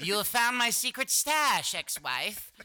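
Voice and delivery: high, slow, pompous villain voice; hammed-up villain. Supervillain addressing the ex-wife, hammed up and slow. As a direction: play a grandiose supervillain.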